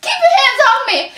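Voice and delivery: high, sharp shout of anger; doctor flings off the fraud, yelling; angry shout. Acted. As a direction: push him off, furious.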